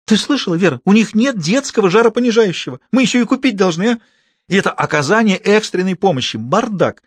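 high, scornful outrage; mock the hospital to her sister; indignant. A narrator's reading. Sister mocks the hospital to Vera.

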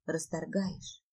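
barely audible, stunned murmur; soft, low near-whisper; hushed whisper. Acted. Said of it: "шепчу".

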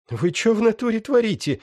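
high-pitched rough bandit; young thug voice. Acted. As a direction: whine it like a wounded gangster. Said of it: "вдруг спросил он". (высокий голос молодого бандита)

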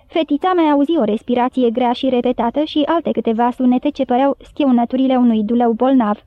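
ordinary delivery, nothing marked

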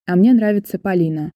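ordinary delivery

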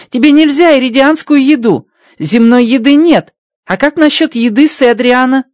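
high, bright-pitched alien voice, amazed; Rocky's alien voice. Acted. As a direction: play the little alien engineer, delighted. Rocky thinks aloud.

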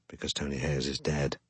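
muttered under breath. A narrator's reading. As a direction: mutter it to yourself. Repeated aside, dropped low.

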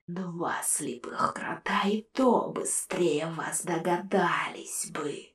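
soft, slow spectral sneer; whispered ghostly scorn; mocking ghost. A narrator's reading. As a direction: taunt them from inside the jar. Ghost skull voice.

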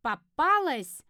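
loud, drawn-out gloating cry; high, ringing victory call; triumphant. A narrator's reading. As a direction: crow it slowly in triumph. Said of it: "торжественно произнёс он".

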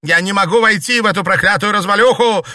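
high, loud and fast; furious scream. A narrator's reading. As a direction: shriek it in fury. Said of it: "крикнула она".

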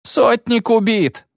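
high, drawn-out wail; moaned. A narrator's reading. Frightened soldier reports the centurion killed, moaning high. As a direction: wail the bad news. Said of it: "простонал другой".

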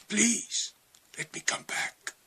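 fading into a whisper, begging; desperate whispered plea. Retold or imitated. Retelling his plea to Jesus, fading to a whisper. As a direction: beg so softly it almost vanishes. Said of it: "I said".

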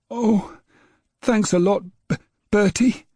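put-on high, stammering boy's voice; a little boy stumbling on his words, fed up with his dog; young boy James. A narrator's reading. Small boy grumbling at his barking dog, high and stammering.

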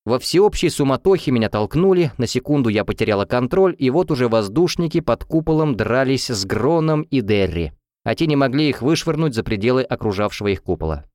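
plain reading voice

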